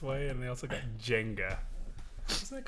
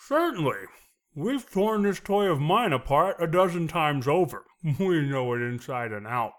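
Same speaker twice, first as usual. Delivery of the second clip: loud line spoken while chewing; chewing while talking; 4 semitones higher, 8 dB louder than usual. Acted. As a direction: deliver it loudly mid-chew. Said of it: "try it through three different ways".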